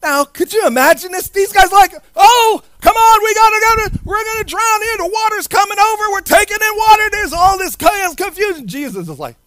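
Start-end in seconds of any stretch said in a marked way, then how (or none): 2.2-8.7 high-pitched frantic panic, words tumbling out; panicking fishermen. Acted. Preacher playing fishermen in a sinking boat, high panic. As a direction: act out a crew about to drown.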